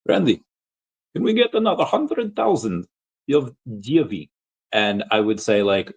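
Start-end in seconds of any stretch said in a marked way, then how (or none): 0.1-4.3 high-pitched mock Russian accent. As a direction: imitate a friend with a put-on Russian accent. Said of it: "I'll do a, a Russian accent".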